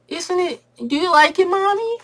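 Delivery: put-on squeaky high little kids calling Mommy; kid voices. Kids begging their mom to come see. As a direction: chirp it up high like excited little boys.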